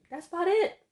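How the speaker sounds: high, loud that's about it; emphatic. Real, not acted. Wrapping up the offer letter example, emphatic and high. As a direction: rise up hard on it. Mocking how simple letters are.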